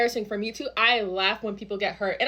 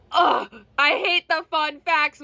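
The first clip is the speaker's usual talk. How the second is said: high, drawn-out mock complaint; slow, whiny exasperation; exasperated groan; 4 semitones higher, slower than usual. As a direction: groan it out in exaggerated dread. Her reaction to classroom icebreaker.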